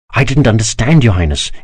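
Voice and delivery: ordinary delivery, nothing marked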